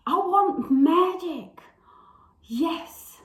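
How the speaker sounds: high, theatrical monologue with long pauses; Blanche. Acted. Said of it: "I will be Blanche".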